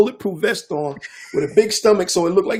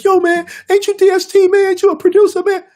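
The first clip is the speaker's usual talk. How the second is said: put-on much higher, louder starstruck kid voice; starstruck young kid; 6 dB louder than usual. Armed youths recognizing the producer, high starstruck voice. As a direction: squeak it up like a kid meeting his idol. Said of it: "they were like".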